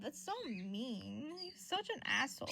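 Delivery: ordinary delivery, nothing marked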